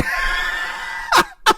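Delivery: shrieking breathy laugh. Real, not acted. Teller cracking up at his own story, high wheezing laugh.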